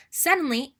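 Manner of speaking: ordinary delivery, nothing marked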